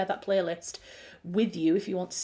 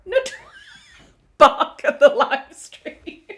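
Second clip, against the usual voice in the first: loud, slow, higher scold; laughing scold; 9 semitones higher, 11 dB louder, slower than usual. Real, not acted. Telling the dog off for barking on stream, loud scolding. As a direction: scold the dog loudly through amusement.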